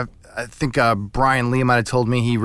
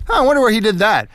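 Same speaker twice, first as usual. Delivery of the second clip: curious; 9 semitones higher, faster than usual. Retold or imitated. Imagined viewer thought.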